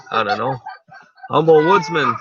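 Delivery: ordinary talk, nothing marked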